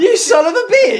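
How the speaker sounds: high, shouted fake indignation; mock outrage. Mock outrage at teacher story.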